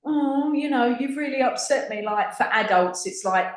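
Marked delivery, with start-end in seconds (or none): 0.0-2.0 mock sulky adult. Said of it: "They're like".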